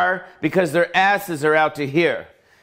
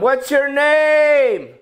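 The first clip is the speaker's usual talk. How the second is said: high, slow shouted question over the music; screaming over club noise; 11 semitones higher, 4 dB louder, much slower than usual. Retold or imitated. Shouting to a girl across the noisy club. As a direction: yell it across a loud club. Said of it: "I had to scream to the other room".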